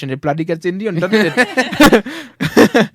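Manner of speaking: loud Indian-tinged accent, rising at the end; Scottish attempt slipping into Indian accent; accent sliding into Indian. Shown as a demonstration. Mocking own accents, loud drift into Indian. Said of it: "my accents do deteriorate into Indian".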